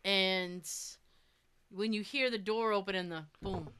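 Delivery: usual speaking voice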